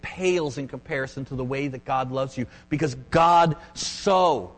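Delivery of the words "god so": "so" drawn out long and louder; stress on SO. Real, not acted. Preacher quoting John three sixteen, stress on SO. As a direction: hold the word "so", slow and emphatic. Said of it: "the so, if you want to circle it".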